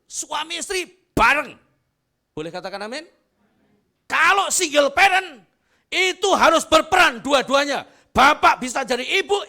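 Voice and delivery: loud, high shouted sermon climb; full-force preaching. Acted. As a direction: preach it to the back row. (khotbah berapi-api dengan suara lantang)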